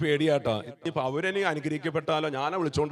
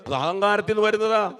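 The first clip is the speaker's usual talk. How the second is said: ordinary talk, nothing marked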